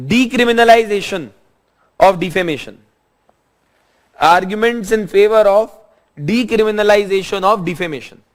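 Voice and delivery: slow, measured note-dictation delivery. Real, not acted. Teacher giving students notes to write down, slow dictation. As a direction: dictate in short phrases with long pauses.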